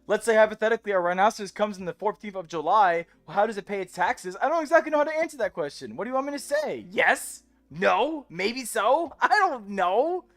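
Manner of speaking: high, loud, incredulous ranting; exasperated rant. Real, not acted. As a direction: throw up your hands at a silly question. Mocking chat's silly hypotheticals.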